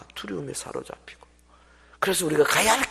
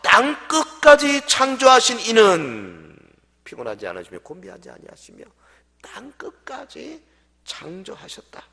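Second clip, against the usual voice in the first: loud, slowing solemn reading of the verse; scripture reading; 3 semitones higher, 11 dB louder than usual. Acted. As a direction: read the verse like a proclamation. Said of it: "본문 이십팔 절". (성경 구절 낭독)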